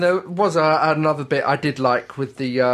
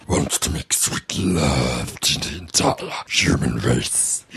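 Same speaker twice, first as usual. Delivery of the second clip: extremely deep, slow loverman voice; voice forced down low; 13 semitones lower, slower than usual. Shown as a demonstration. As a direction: push it painfully low, as deep as it goes. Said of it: "Well, I can, but it hurts me".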